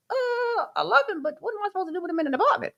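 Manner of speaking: high-pitched, rushed mock protest; reluctant protest. Retells own reluctant answer.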